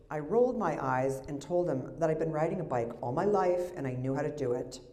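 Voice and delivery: ordinary talk, nothing marked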